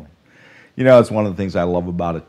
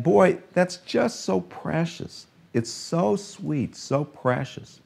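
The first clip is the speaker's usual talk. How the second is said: softer, higher and slow, so precious lingered over; tender; 8 semitones higher, 5 dB quieter, slower than usual. Real, not acted. Father tells congregation about his loving children, tender and hushed. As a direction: melt over how precious it is.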